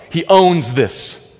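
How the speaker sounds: ordinary delivery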